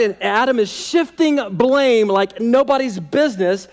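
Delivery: normal talking voice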